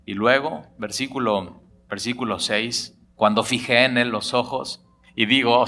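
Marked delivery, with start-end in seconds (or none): none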